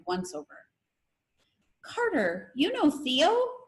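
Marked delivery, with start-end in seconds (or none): 1.8-3.6 high, slow, rising British-free surprise on Theo; surprised. A narrator's reading. Lila surprised to find Carter knows Theo, high and slow. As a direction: stretch out you know Theo? in disbelief.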